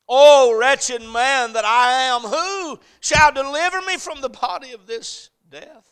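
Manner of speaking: slow, high, shouted lament; anguished outcry. Acted. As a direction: wail it out at full preaching force. Preacher cries out Paul's lament.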